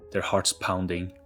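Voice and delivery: ordinary delivery, nothing marked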